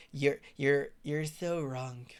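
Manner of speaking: a slowed, dweeby imitation; mocking slow whine. Mocking the Trump supporter's slow nerdy put-down. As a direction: drag out you're so wrong, nerdy and slow. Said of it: "That's how he talks".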